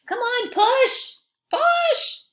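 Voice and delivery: very high, loud, slow pretend strain; straining push. Reader straining with listeners to push the cart, high and loud. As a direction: heave as if pushing the heavy cart.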